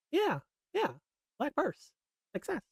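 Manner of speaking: high, airy goofball voice; Chit's catchphrase. Retold or imitated. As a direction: squeak it out proudly.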